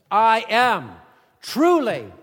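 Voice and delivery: loud, high proclamation; God's declaration. Acted. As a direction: proclaim it with full divine authority. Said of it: "God is the one who says".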